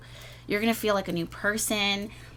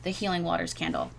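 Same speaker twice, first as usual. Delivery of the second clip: ordinary talk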